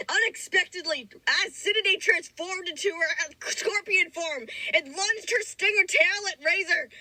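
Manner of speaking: heightened, mock-epic narration; story narration. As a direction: read the fanfic like an epic saga. Dramatic fanfic read aloud.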